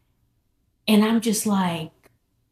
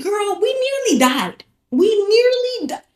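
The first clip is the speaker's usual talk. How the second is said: much higher, frantic; panicked high; 11 semitones higher than usual. Retold or imitated. Telling her friend they nearly died, frantic and high. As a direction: gasp it out in a panic.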